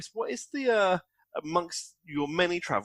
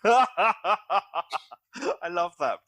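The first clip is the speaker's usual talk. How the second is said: laughing; 6 semitones higher, 9 dB louder, much slower than usual. Real, not acted. Host laughs at witch story.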